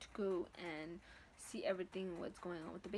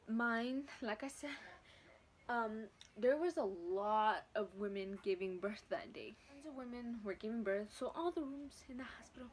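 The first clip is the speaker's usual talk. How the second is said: ordinary talk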